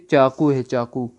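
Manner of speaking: ordinary talk, nothing marked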